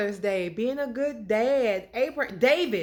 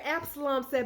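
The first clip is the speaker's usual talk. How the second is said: normal talking voice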